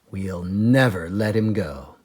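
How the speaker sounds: ordinary delivery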